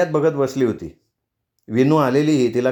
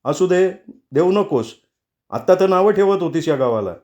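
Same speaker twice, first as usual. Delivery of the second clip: higher, mildly angry reproach; angry refusal; 5 semitones higher than usual. A narrator's reading. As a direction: refuse with restrained anger. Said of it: "केदारच्या डोळ्यात निखारे होते".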